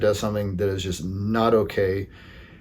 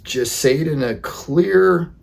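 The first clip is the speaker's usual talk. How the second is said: ordinary talk, nothing marked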